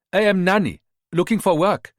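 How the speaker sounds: job-seeker with a Ghanaian lilt; Ghanaian accent. Acted. Rita posing as a maid to the mother, Ghanaian accent. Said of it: "staying with the Ghanaian accent".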